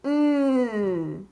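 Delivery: long sustained nnn; drawn-out N sound alone; letter sound N. Shown as a demonstration. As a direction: hold "N" as a continuous hum, not the letter name. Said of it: "Let's say that sound again".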